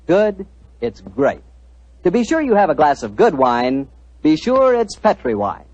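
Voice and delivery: ordinary talk, nothing marked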